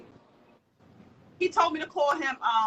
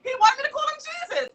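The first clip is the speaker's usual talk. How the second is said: laughing disbelief; 3 dB louder, faster than usual. Real, not acted. Laughing retelling the name.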